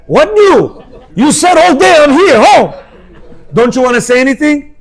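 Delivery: high, loud, laughing protest. Retold or imitated.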